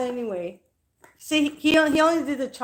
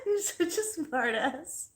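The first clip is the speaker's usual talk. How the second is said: giggling through it; laughing; 3 semitones higher, 6 dB quieter, slower than usual. Real, not acted. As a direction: laugh out the words. Laughing at his sarcasm.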